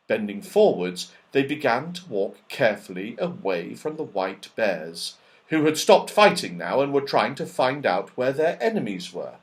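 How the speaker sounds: usual speaking voice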